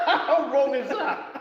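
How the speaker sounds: high, slow, laughing question; giggling at a rude joke. Real, not acted. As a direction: barely get it out through laughter. Cracking up at a joke.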